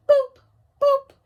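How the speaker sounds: short, high, loud boops; high boop sound. Shown as a demonstration. Teacher showing the listening sound, high boops. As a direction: pop out two bright boops. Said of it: "It goes just like this".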